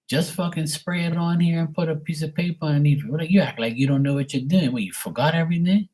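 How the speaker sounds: high-pitched exasperated rant; impatient retort. Retold or imitated. Impatient retort to dad, retold.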